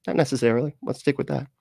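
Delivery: ordinary talk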